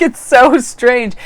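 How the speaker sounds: high, loud, drawn-out marvelling; retold amazement. Vlogger quoting her manicurist's wonder at feeling happy, amazed and stretched out.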